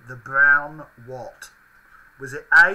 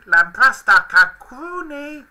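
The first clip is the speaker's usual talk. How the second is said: high Furby voice; 8 semitones higher, 8 dB louder, slower than usual. Pretend. Furby phrase read out again.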